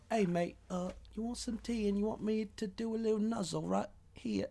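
high, soft mock English accent; hammed-up English charmer. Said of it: "with his little English accent".

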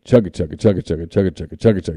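low chugging train noise. Retold or imitated. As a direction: chug like a toy train. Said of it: "Off goes the train".

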